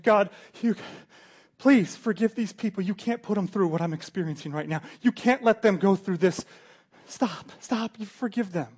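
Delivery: loud, high, desperate begging to God; anguished plea. Acted. Jesus on the cross begs God to spare the people, loud anguished plea. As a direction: cry out to the Father in agony.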